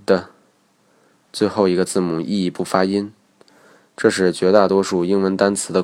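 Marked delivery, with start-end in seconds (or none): 0.1-0.3 "的" as the short d sound; 字母读音. Shown as a demonstration. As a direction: sound out "的" for letter D, not "dee". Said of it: "字母D只能读成". (把 "的" 读成字母D的短促辅音)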